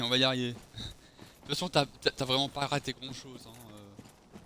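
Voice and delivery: usual speaking voice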